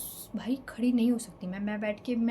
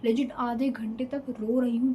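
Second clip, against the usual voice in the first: ordinary talk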